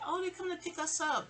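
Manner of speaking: high-pitched, pleased exclamation from a retold memory; excited child. Girl spotting her relatives at the gate, excited. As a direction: lift it high with happy expectation. Herself as child, glad to see aunt.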